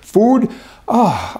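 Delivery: high, loud scoffing "Food?"; mocking quote. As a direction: mimic him, scornful and incredulous. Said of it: "And now he's saying".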